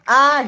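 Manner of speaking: high, loud "ah"; short high yell; acted scream. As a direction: cry out in alarm. Engine gets stuck.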